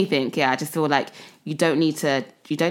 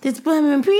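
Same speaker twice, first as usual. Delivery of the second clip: quoting TikTok, high; 10 semitones higher, 4 dB louder than usual. Retold or imitated. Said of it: "It's on TikTok".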